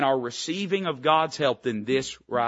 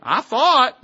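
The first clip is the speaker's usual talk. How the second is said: much higher, louder mock whine; mocking Naaman; 9 semitones higher, 8 dB louder than usual. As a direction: squeal it like a proud man offended. Mocks Naaman's proud thought.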